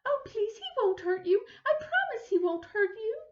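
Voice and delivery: high pleading reassurance; reassuring. Acted. Missus Prim pleading with the frightened neighbor, high and soothing. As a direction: beg her to calm down. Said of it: "tried to reassure her".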